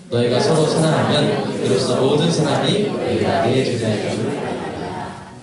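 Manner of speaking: slow, deliberate unison Bible reading; scripture reading. Acted. Pastor reading the last verse with congregation, slow scripture reading. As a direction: lead everyone firmly through the verse.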